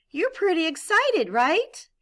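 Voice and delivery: high, bright voice showing excitement; excited. Shown as a demonstration. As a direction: sound thrilled for the kids. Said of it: "showing excitement".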